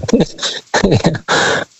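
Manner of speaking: breathy little giggle; short laugh. Real, not acted. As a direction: laugh it off nervously. Caller laughs, shortly after being asked. (kurzes verlegenes Kichern)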